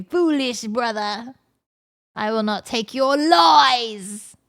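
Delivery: slow, theatrical mock rebuke; scornful brother character. Brother refusing the oats tale's lies, hammed-up scorn. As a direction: declaim it like a grand refusal.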